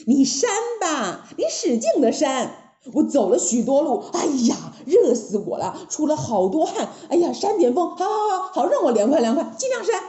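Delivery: high, animated, cocky monkey hero; taunting Monkey King. Acted. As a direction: egg the princess on gleefully. Voices Sun Wukong taunting.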